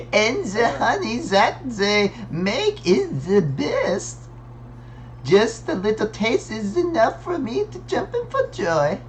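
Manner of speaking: put-on high, drawn-out German accent. Game character praising the honey, in a German accent. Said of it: "there's a German accent".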